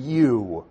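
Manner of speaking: "you" set apart as a direct address; pointed, emphatic YOU. Shown as a demonstration. Preacher pointing a finger at the lawyer, emphatic "you". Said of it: "pointing your finger at him".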